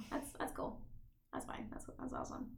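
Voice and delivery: very quiet, half-whispered resignation; mock acceptance. Viewer pretending the plot hole is fine, muttered resignation. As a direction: mutter it almost without voice, pretending approval.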